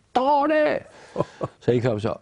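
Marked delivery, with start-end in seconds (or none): 0.1-0.8 very high-pitched excited shriek; shrieked command, pitched way up; imitated scream. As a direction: yell it out high, mimicking a friend's scream. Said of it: "Ulf bara skrek".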